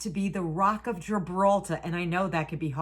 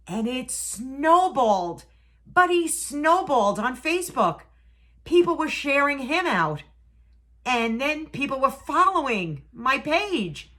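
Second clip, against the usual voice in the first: higher and louder, slowing with joy; excited; 7 semitones higher, 7 dB louder than usual. Real, not acted. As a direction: lift up in delighted wonder. Excited about Buddy going viral.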